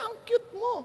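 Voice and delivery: high, gushing compliment; mock fan voice. Preacher jokingly voicing an admirer, high and gushing. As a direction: gush it playfully. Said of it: "sabi niya".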